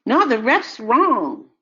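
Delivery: digging in against the grown-ups; insistent kid. Retold or imitated.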